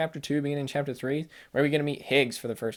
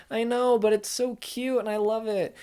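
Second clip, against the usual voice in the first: put-on much higher, doting baby-love voice; gushing coo; 9 semitones higher than usual. Vlogger insisting to his wife he loves the BB, high doting coo. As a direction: dote on it like a new parent.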